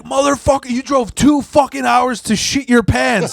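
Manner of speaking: high, loud mock yell of the furious boss; hammed-up screaming boss. Boss yelling at a worker who soiled himself, loud mock rage. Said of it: "he's screaming at".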